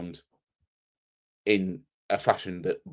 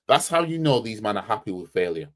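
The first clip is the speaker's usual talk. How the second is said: ordinary delivery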